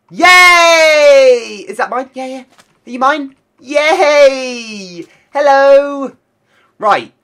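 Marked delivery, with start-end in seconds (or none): 0.1-6.2 high, loud squealing yay; real delight, squealed high and loud; excited cheering. Real, not acted. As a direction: celebrate taming the wolf, loud and high. Wolf tamed, cheering.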